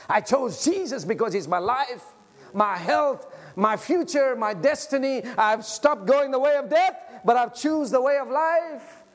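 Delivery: loud, high preacher's cry; full-force preaching. Acted. Pastor urging the congregation to be full of Jesus, preaching loud. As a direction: preach it out to the whole church.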